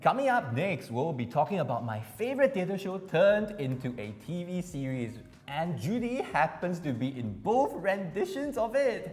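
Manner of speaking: upbeat host intro; announcer voice. Acted. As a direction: announce the next segment brightly.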